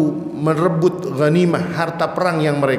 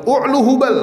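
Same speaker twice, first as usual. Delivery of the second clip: imitated proud battle cry, raised pitch; proud boast; 8 semitones higher, 4 dB louder than usual. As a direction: call it out high like a boast. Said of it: "dengan bangganya sambil mengatakan".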